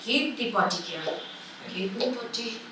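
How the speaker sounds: very soft questioning murmur; soft baby talk. Acted. Mother checking baby's potty, soft baby talk. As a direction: ask the baby softly, almost to yourself.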